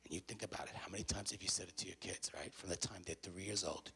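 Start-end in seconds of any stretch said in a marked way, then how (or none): none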